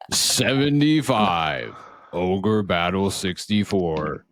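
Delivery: slow, drawn-out gala announcer. Acted. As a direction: announce each title like a trophy. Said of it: "It's like we're at the Game Awards".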